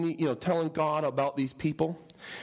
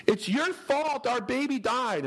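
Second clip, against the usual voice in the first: accusing crowd; 9 semitones higher, slower than usual. Retold or imitated.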